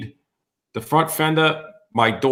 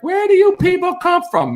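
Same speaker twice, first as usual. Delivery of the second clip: very high-pitched put-on woman's voice; mimicked old lady; 4 dB louder than usual. Old lady scolding the intruders, high mock voice. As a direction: squeal it as an affronted old lady.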